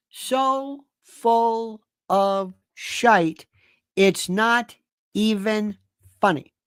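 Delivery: very slow, "So... full... of... shite" with each word stretched; drawn-out emphasis. Real, not acted. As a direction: spell it out word by word, pausing between "not", "even" and "funny". Repeats insult, slowly drawn out.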